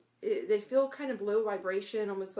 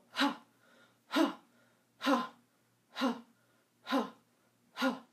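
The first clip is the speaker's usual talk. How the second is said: repeated ha sounds; 4 semitones higher, slower than usual. Shown as a demonstration. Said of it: "So if you go".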